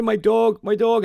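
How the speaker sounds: retold crying plea. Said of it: "I'm crying".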